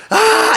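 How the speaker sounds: high-pitched yell imitating a stage scream; sudden high mock shriek; imitated scream. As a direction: mimic a full-energy scream. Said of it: "And he screams to the top of his".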